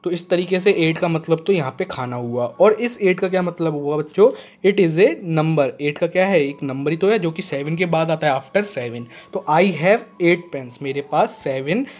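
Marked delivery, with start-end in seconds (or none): 4.6-5.7 slow, word by word; slow dictation. Shown as a demonstration. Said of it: "teacher dictating a definition". 9.5-10.8 very slow, word by word; slow dictation. Shown as a demonstration. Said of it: "teacher dictating an example sentence".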